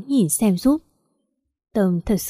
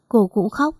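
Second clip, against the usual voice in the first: ordinary delivery, nothing marked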